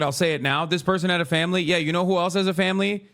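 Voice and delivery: usual speaking voice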